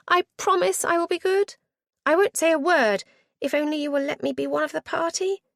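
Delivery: put-on high, bright eager girl voice; eager young niece. A narrator's reading. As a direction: play the keen niece, rushing. Niece pleading.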